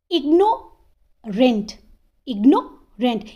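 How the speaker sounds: Ignorant slowly split, gap after IGNOU; ignorant in two separated parts; pause mid-word. Shown as a demonstration. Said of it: "यहाँ पे भी दो syllable है".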